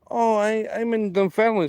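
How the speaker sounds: put-on English accent. Bank lass says where she is, in a mock English accent. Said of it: "in pure English accent".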